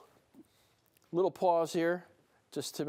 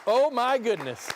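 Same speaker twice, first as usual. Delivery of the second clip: excited; 6 semitones higher, 6 dB louder than usual. Real, not acted. Coin just sold.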